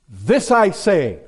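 "This I say" slow and forceful; strong declaration. Acted. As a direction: weigh each word of "This I say" firmly. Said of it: "that's coming across pretty strong".

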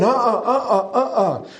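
high, sing-song uh-uh with a laugh; playful refusal. Retold or imitated. Jeremiah turning down God, a playful laughing no. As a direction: shake it off like a kid saying no thanks.

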